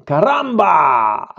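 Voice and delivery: long, drawn-out theatrical shout; shouted cry. Acted. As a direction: cry it out slowly like a dramatic exclamation. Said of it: "en criant". (cri théâtral prolongé)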